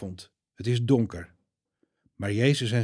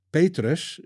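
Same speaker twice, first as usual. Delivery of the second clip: stern; 5 semitones higher, 3 dB louder than usual. A narrator's reading. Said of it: "zegt Jezus streng".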